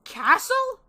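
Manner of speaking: loud, high astonished question; surprised yell. Acted. Narrator hears of the castle, yelling in surprise. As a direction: blurt it out, pitch shooting up. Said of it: "I couldn't help but yell in surprise".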